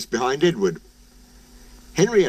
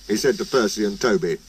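usual speaking voice

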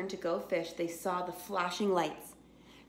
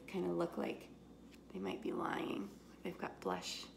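conspiratorial whisper; 9 dB quieter than usual. Real, not acted. Reader confiding in listening children, whispering. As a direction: lean in and hush, sharing a secret.

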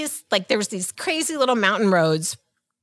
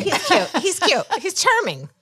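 higher, dreamy gushing; playful swoon; 7 semitones higher than usual. Retold or imitated. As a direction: gush about him playfully. Playing along with mock swoon.

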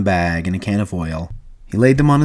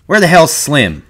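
loud, raised, aggressive demand; threatening; 7 semitones higher, 5 dB louder than usual. Acted. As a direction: demand it with menace. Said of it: "Curly looked threateningly about the room".

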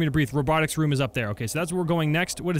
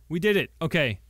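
ordinary talk, nothing marked

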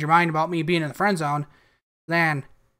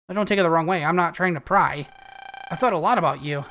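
ordinary talk, nothing marked

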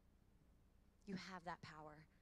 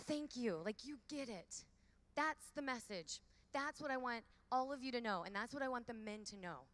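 louder, higher, beaming thank-you; happy thanks; 4 semitones higher, 5 dB louder than usual. Retold or imitated. Speaker retelling her delighted thanks to the young man, excited and loud. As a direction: gush your gratitude. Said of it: "So I did a happy dance".

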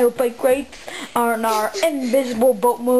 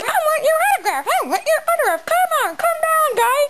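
very high, fast squeaky fan voice; frantic high-pitched pleading fan imitation; screaming fans; faster than usual. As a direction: shriek it like a begging fangirl at the door. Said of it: "And they're like".

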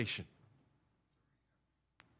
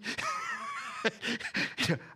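big laugh; 4 dB quieter than usual. Real, not acted. Laughs at own joke.

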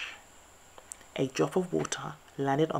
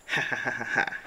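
sniggering; 3 semitones lower than usual. A narrator's reading. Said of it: "the wolf sniggered".

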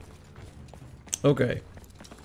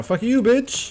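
gloating taunt; 11 semitones higher, slower than usual. Real, not acted.

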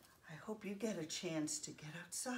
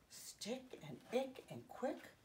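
stick, ick and quick said slowly, each rhyme leaned on; slow down and lean on each ick sound in stick, ick, quick; spelling out the rhyme for kids; rhymes stressed; 4 semitones higher, 4 dB quieter, slower than usual. Shown as a demonstration. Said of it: "they sound alike".

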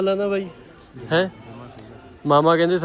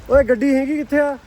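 high, loud puzzled shout; calling out; 10 semitones higher, 4 dB louder than usual. Real, not acted. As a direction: shout out where the car is. Searching for the car in rain. (ਉੱਚੀ ਹੈਰਾਨ ਆਵਾਜ਼)

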